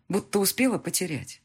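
ordinary delivery, nothing marked

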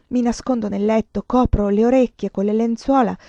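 ordinary delivery

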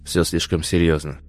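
quiet command that allows no argument. A narrator's reading. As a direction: order it softly, brooking no objection. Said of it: "Девушка вздрогнула от этого голоса, ослушаться которого не было ни малейшей возможности". (тихий, не терпящий возражений приказ)

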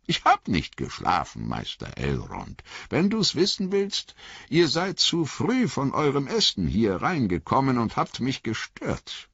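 put-on high, cheerful old hobbit; high old hobbit voice, warmly pleased; old hobbit Bilbo. A narrator's reading. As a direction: greet him like a fond old uncle.

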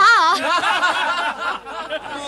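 high, loud burst of laughter. Acted. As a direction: burst out laughing at the child's question. Laughing at the child's question. (hohes, lautes Auflachen)

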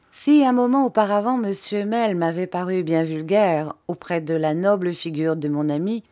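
ordinary delivery, nothing marked

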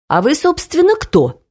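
haughty, starting high and dropping on the last word; self-important. A narrator's reading. Mama challenging the angry man, self-important. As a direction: put on airs while questioning. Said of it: "заважничала моя мама".

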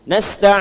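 "Nasta'in" starting on a clear na; opening fathah. Shown as a demonstration. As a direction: chant "Nasta'in" with an a vowel after n. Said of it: "dibacanya fathah depannya". ("Nasta'in" diawali na berfathah)

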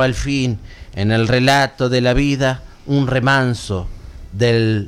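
slow, low, solemn poem recital; poem reading. Acted. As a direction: read the verses slowly and gravely. Reads a poem aloud. (recitado de poema lento y solemne)

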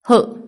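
short, high, loud grunt of pain. A narrator's reading. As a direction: grunt as if struck by a blow. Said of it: "Thạch Trung Nguyên đau đớn kêu rên".

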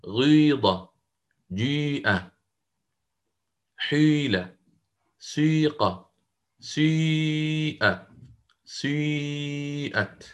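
قيل and the others with the kasra leaning towards damma, long held vowels; shuyu ishmam list. Shown as a demonstration. Teacher reciting the list for students, i leaning toward u. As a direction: recite each word with a rounded i blending toward u. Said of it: "يعني تطبيقًا كيف سيكون؟".

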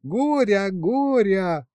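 put-on high, drawn-out baby-talk call; cooing. A narrator's reading. Woman calling a baby dragon, cooing high. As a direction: coo in a high voice to a little one. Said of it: "засюсюкала Варя".

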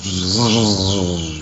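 long buzzing insect noise; mosquito buzz. Retold or imitated. Storyteller imitates mosquitoes outside headquarters, buzzing. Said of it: "stupid sound effects".

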